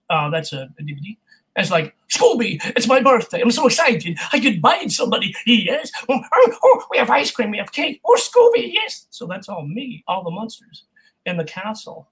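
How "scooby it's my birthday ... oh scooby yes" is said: excited cartoon monster. Shown as a demonstration. Voice actor demoing a giddy Scooby-Doo monster, excited squeal. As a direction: squeal with birthday glee, climbing higher. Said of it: "I was all the monsters in Scooby-Doo's castle".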